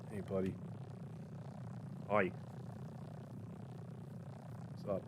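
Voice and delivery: almost breathed, very soft; barely above a murmur to the cat; hushed pet greeting. Real, not acted. Greeting Speedy the cat, very soft and hushed.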